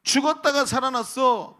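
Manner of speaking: father exclaiming with joy over his returned son; joyful father. Retold or imitated. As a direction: savour the joy of the son's return.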